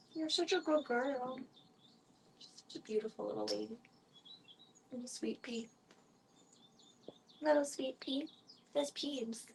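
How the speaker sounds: soft high cooing. Real, not acted. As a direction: coo at the kitty. Cooing to cat.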